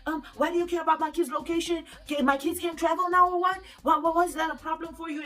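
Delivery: high, insistent mock-defensive retort; getting defensive. Boyfriend challenged about his kids' location, acted defensive. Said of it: "he started getting defensive".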